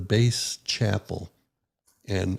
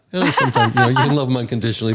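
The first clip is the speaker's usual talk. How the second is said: laughing; 4 semitones higher, 8 dB louder, much faster than usual. Real, not acted. Laughs after guilt joke.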